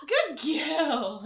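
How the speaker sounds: delighted pet praise. Real, not acted. Host praises Elsa for jumping up, delighted and laughing.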